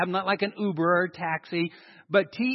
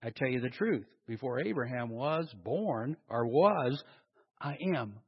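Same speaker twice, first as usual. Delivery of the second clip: solemn hush; 8 semitones lower, 7 dB quieter than usual. Acted. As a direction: let the claim land quietly. Jesus' solemn claim read softly.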